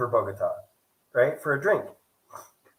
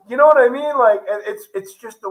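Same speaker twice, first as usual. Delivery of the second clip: worked-up exclamation; 8 semitones higher, 9 dB louder than usual. Real, not acted. Ranting about overpriced drink.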